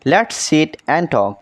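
very slow, each word drawn out for learners; slow model reading. Shown as a demonstration. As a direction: read it slowly for the class. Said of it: "slow model sentence". (ধীরে পড়া)

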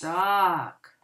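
usual speaking voice

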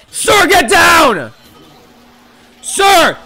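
very high, loud, drawn-out yell; slow screaming guard warning; bodyguard shout. Acted. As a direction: throw yourself in front of the boss.

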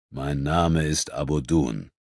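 calm reply. Acted. Calm Nubian answers.

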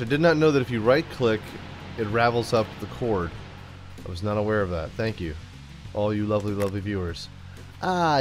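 ordinary delivery

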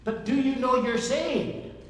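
high, loud mock evangelist; hammed-up pushy believer pressing hard. Preacher mimics street evangelist pressing him, hammed-up high and loud.

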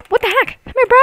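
real excited surprise; startled hype. Real, not acted. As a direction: yelp and chase. Sudden fight with fast enemy.

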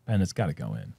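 low, soft muttered hope; hushed, downcast urging; quiet plea. Real, not acted. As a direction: plead under your breath for the putt. Muttered plea over the putt.

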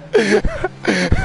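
squealing giggle; high giggle. Real, not acted. Laughing at game chaos.

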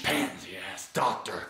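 whispered, soft insult; sulking whispered jab; muttered under breath. Acted. Coach mutters insult afterwards.

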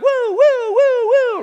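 high, loud wailing "woo"; long, high-pitched siren whoops; siren imitation. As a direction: wail like a siren, drawn out. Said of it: "I got a siren on my cart".

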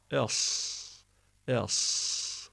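"es" with a dark vowel and very long S; snake-like hiss. Shown as a demonstration. Teacher demonstrating the letter sad, long hissed "es". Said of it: "dudaklarımızı açarak s".

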